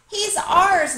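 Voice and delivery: proud announcement. A narrator's reading. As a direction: announce it proudly like a delighted child. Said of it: "May announced".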